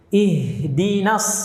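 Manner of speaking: very slow "Ihdinas", H streaming until "di"; drawn-out "Ihdinas" with airy H; flowing H. Shown as a demonstration. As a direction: let the breath of H run into "dinas". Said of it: "Dialirkan suaranya".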